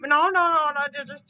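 loud hurried pleading; panicked no. Acted. Baldy panicking at another spell, loud. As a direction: beg him to stop, flustered.

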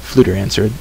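usual speaking voice

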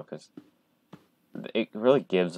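ordinary talk

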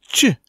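"チュ" as a short, isolated ch sound; CH sound demo. Shown as a demonstration. Teacher showing learners how C and H join, "チュ" pronounced alone. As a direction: sound out "チュ" as the blend of C and H. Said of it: "発音は".

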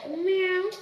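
high, loud playful meow; cat meow. Pretend. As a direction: meow like a kitten. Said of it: "I'm a cat".

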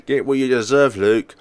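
loud gloating sign-off. Acted. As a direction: relish the threat. Gloating character.

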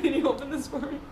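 high, wheedling request; pleading. Pretend. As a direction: ask like a helpless little kid. Wheedling partner to open package.

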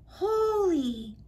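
high, startled exclamation; shocked gasp. A narrator's reading. Lonnie reacts as the rock hits a bird, high and shocked. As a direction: caught off guard, blurt it. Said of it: "Lonnie said".

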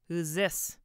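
put-on loud, thick drunken demand; thick-voiced demand. A narrator's reading. Drunk husband finds a stranger at home, demanding thickly. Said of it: "he demanded thickly".

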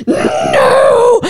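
sudden high loud burst of excitement; a dog blurting no, far too excited to sit; excited dog shout. Acted. Dog refusing to sit before swimming, yelping an excited no. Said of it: "the dog is so excited".

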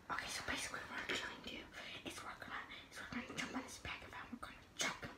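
fast hushed whisper. Real, not acted. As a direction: whisper the plan quickly. Secret plan off camera.

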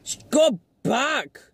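high-pitched frustrated exclamations; exasperated urging. Real, not acted. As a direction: urge a hopeless driver on, pitch leaping. Yelling advice at the driver.

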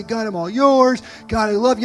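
loud, fervent promise to God; teen at camp vowing to God, loud and fervent; 1 - impassioned camp vow. Retold or imitated. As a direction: pour it out like a teen on fire at camp. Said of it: "I would say".

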